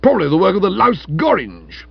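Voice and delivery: high, loud, contemptuous jibe; coarse insult. A narrator's reading. Stilton insulting Florence's book, loudly scornful. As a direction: throw the insult out hotly. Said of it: "at this coarse insult".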